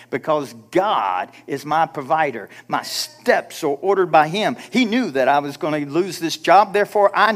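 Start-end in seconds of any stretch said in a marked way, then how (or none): none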